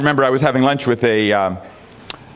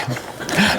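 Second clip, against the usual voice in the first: soft laugh; 6 semitones higher, 4 dB quieter than usual. Real, not acted. Laughs after joke.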